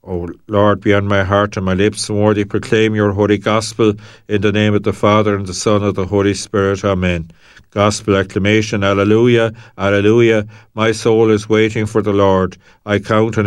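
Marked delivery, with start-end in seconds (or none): 4.3-7.3 fast, run-together sign of the cross; rushed blessing formula. Acted. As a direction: rattle off the blessing quickly.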